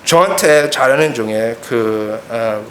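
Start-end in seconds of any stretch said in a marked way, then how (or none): none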